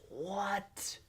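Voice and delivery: breathless, whispered what; whispered disbelief. Real, not acted. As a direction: gasp it out in shock. Shocked by old price.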